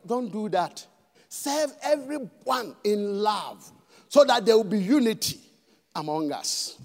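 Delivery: ordinary talk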